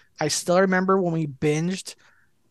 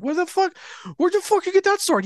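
high-pitched mock disbelief; retold astonishment; 13 semitones higher than usual. Friends circling the player to gawk at his sword, astonished. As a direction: replay a gobsmacked, envious question, much higher.

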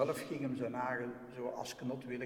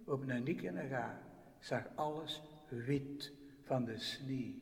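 ordinary delivery

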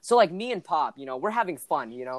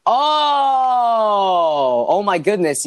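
dawning recognition; 8 dB louder, much slower than usual. Real, not acted. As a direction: let the "Oh" ring out, then talk on loudly. Sudden recognition of the rapper.

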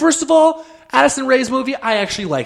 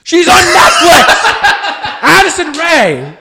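high, loud, laughing; laughing shout; 8 semitones higher, 6 dB louder than usual. Pretend. Parent shouting rival name.

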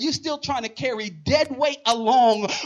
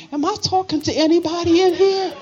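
raised, high preacher's challenge; calling out; 7 semitones higher, 4 dB louder than usual. Real, not acted. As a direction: call out to the congregation for a response. Calls out to congregation for response.

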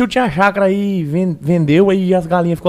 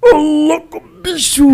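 Faustão impression; 9 semitones higher, much slower than usual. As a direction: bellow it like a TV host. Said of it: "ele imita o Faustão".

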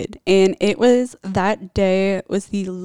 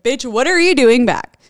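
mock cop shout; 5 semitones higher, 8 dB louder than usual. Cops confront her at house.

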